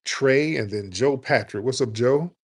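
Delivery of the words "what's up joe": ordinary talk, nothing marked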